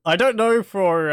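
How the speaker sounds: sudden loud outburst. Real, not acted. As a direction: blurt it out loudly. Sudden loud burst while gaming.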